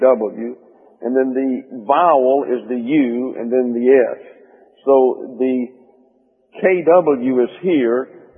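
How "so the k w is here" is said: usual speaking voice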